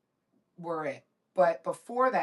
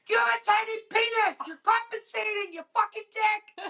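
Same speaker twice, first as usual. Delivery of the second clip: high, loud taunting yell; imitated yelling; 14 semitones higher, 5 dB louder than usual. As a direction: yell insults across the dock, drawing out the end. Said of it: "the guy started yelling at Jacob".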